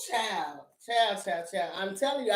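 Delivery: ordinary talk, nothing marked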